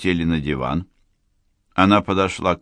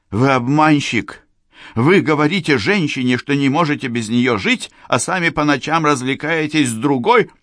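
relentless accusation; 8 semitones higher, 4 dB louder than usual. Acted. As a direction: keep pressing, no mercy. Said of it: "Она продолжала давить".

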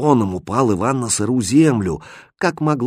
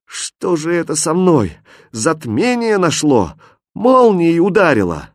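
high voice, growing louder; dazed hero exclaiming; bewildered exclamation; 7 semitones higher, 4 dB louder than usual. A narrator's reading. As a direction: cry out in confusion, building in alarm. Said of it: "и воскликнул".

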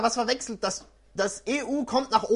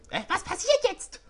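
put-on higher girlish question; lightly voiced girl; 11 semitones higher than usual. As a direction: ask it as the girl. Regine voice.

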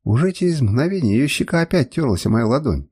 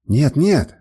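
mildly raised, angry refusal; angry cry; 3 dB louder than usual. A narrator's reading. Woman refuses the order to dress, mild angry cry. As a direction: refuse angrily, narrator's restrained shout. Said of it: "гневно закричала она".

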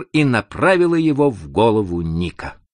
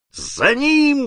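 high-pitched drawn battle cry; narrator's acted yell to give chase; roar. A narrator's reading. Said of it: "в свою очередь заревел Панха".

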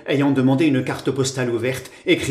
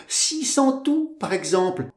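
high, smiling lilt; lightly teasing, pitched up; smiling reply; 9 semitones higher than usual. A narrator's reading. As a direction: top her accusation with a smile. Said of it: "répondit en souriant Georgette".

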